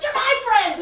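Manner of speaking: high, loud, laughing mock protest; possessive protest. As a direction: jealously claim your friend, hammed up. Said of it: "You feel this possession".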